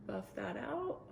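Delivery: ordinary talk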